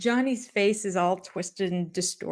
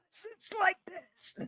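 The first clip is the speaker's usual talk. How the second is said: squeezed, airless, much higher and softer; breathless voice; 13 semitones higher, 9 dB quieter than usual. Shown as a demonstration. Showing how little voice comes out without breath. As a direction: talk with no air left. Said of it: "as much voice as you can get out without breathing".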